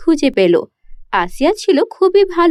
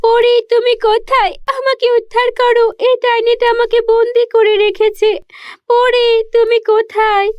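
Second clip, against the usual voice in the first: put-on high child's cry for rescue; a captive girl calling out to the fairy; calling for help; 9 semitones higher than usual. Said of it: "ও চিৎকার করছিল সাহায্যের জন্য". (বন্দি মেয়ের সাহায্যের জন্য উঁচু গলায় ডাক)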